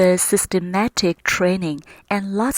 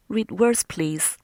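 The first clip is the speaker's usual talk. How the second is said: ordinary delivery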